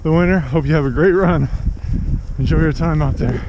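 loud, slightly breathless while running. Real, not acted. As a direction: talk over your stride. Talking while doing speed work.

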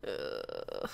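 long, very low creaky groan; frustrated groan. Real, not acted. Player lost near the desert, groaning in frustration. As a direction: drag out a low, creaky ugh.

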